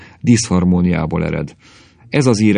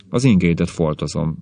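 ordinary delivery, nothing marked

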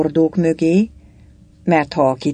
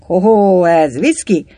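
loud, raised "hoho" of discovery; mock-surprised muttering; 4 semitones higher, 5 dB louder than usual. A narrator's reading. A soldier tasting the broached barrel, exclaimed muttering. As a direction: voice it as a pleased find. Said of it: "mormogott most az egyik".

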